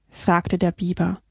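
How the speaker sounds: ordinary delivery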